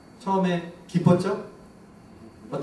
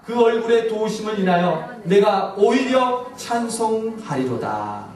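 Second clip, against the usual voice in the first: psalm read aloud; 3 semitones higher, 4 dB louder, slower than usual. Shown as a demonstration. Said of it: "같이 읽을까요? 시작".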